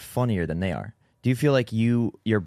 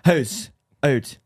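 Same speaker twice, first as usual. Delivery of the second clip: mock Canadian accent; 3 semitones higher than usual. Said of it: "worst Canadian accent".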